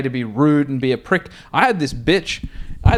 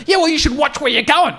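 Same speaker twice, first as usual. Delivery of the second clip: mock angry driver; 12 semitones higher than usual. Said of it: "retold woman driver snaps back".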